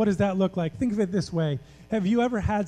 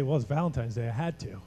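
lower, flat and indifferent, fading out; dismissive date; 6 semitones lower, 4 dB quieter than usual. Retold or imitated. A date telling their partner it was only an obligation, mildly dismissive. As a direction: shrug it off like it's a chore. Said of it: "with the wrong attitude".

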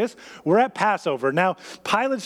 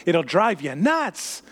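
ordinary talk

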